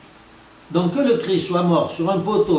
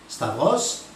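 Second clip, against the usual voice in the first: usual speaking voice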